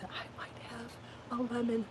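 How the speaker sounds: whispered hopeful aside; excited whisper. Real, not acted. Gardener whispering her hope of a homegrown lemon. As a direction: whisper it like a precious secret.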